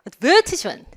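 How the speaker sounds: "advertisement" as ad-VER-tis-ment; British "advertisement" with short "tis"; British pronunciation. Shown as a demonstration. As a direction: stress VER in "advertisement", crisp t. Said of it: "그 다음은요?".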